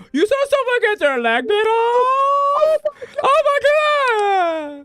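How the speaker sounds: high, slow, wailing shock; shrieking "oh my God" drawn out; mock horrified mom. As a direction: parody a scandalized mother. Mimicking mom's horror.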